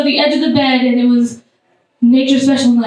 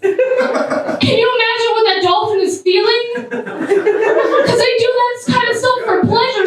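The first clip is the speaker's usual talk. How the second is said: ordinary talk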